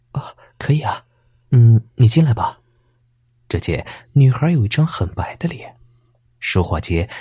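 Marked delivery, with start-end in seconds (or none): 0.1-2.6 embarrassed. A narrator's reading. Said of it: "柳柳有些不好意思".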